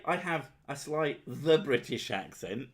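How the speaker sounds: pointedly overstress "the" before British; mock quote. Mock quote disputing the wording.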